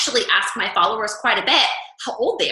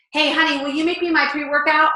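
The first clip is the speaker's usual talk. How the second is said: fake sweet ask; 5 semitones higher than usual. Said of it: "I'm not gonna say".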